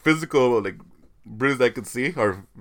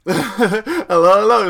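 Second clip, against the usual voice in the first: mock British hello; 7 semitones higher, 5 dB louder, slower than usual. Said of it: "Like, trying to be British or what?".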